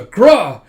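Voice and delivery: high, explosive "Gra!"; loud thrust cry. Retold or imitated. Yor strikes with a palm thrust, explosive cry.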